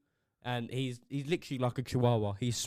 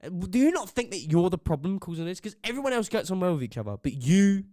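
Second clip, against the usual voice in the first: louder, higher confronting tone; imagined telling-off; 4 semitones higher, 7 dB louder than usual. Pretend. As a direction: tell him off straight to his face.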